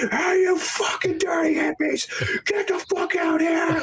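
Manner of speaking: high, strained, drawn-out angry shout; furious yelling. Acted. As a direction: play a raging boss chasing people off. Acted director yelling at hippies.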